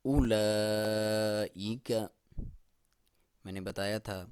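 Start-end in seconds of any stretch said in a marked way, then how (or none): 0.0-1.9 long drawn tajweed chant of اولئک; slow Quran recitation. Shown as a demonstration. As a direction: stretch اولئک slowly, hamza clear. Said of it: "اولئک کے نیچے آپ حمزہ دیکھ رہے ہیں".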